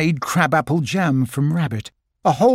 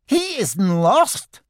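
high, slow and uncertain; unsure Piglet; 6 semitones higher, much slower than usual. Acted. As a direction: say it as if you don't believe it. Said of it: "sounding as if he wasn't quite sure".

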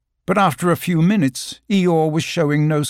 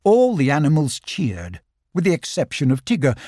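usual speaking voice

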